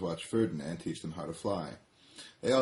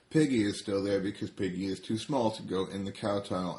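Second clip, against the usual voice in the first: plain reading voice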